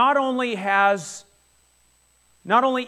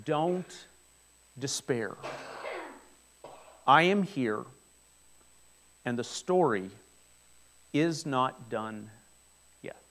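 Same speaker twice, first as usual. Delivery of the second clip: softer, slow reassurance; comforting Jesus; 6 semitones lower, 5 dB quieter, slower than usual. Acted. Jesus speaking to the grieving widow, soft slow comfort. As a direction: gently lift a grieving mother. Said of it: "what he is saying to the lady is this".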